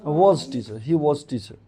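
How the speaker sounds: "was" as "voz", with a rounded "o"; correct pronunciation. Shown as a demonstration. Teacher repeating the sentence for students, correct pronunciation. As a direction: model "was" as "woz", not "vaaj". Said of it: "क्या बोलोगे?".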